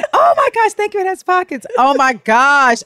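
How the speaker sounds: high, gushing put-on voice; hammed-up squeal of thanks; Becky. Cohost plays every Becky thanked for an outfit, high gushing voice. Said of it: "I could see every Becky saying that".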